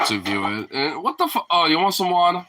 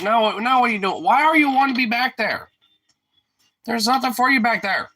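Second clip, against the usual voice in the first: much higher, louder, fussing at a pet; exasperated scolding; 11 semitones higher, 4 dB louder than usual. Real, not acted. Owner scolding the dog for going back there, higher and louder. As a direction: chide the dog for wandering off.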